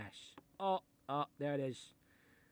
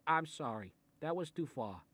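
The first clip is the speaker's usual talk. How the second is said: ordinary talk, nothing marked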